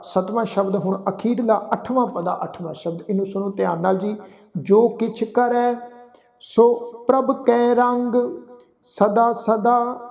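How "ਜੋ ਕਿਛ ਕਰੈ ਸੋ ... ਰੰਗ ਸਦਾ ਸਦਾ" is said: slow, drawn-out sacred recitation; scripture recitation. Acted. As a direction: recite the verse slowly, stretching the vowels.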